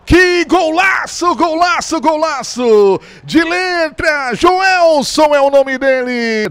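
slow stretched high shouts; excited shout. Real, not acted. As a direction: belt out the praise. Celebrating the goal on air.

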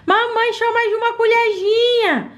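put-on high, pleading child voice; whiny little girl. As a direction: beg mum like a small girl. Child begging.

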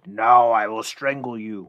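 slow, loud menacing corpse voice; dead man's threat. Acted. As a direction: cry out a drawn-out threat as the risen dead. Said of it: "got up and cried".

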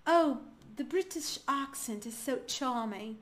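slow, drawn-out British accent. Shown as a demonstration. Teacher demonstrating the silent R, slow British accent. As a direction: show off a British accent with dropped r's. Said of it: "no meio das palavras no britânico".

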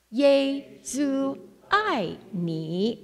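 "Ye zu ai ni" said slowly, syllable by syllable; slow Chinese. Shown as a demonstration. Missionary putting the Chinese phrase together, slowly. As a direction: spell out "Ye zu ai ni" slowly. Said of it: "So now we put it all together".

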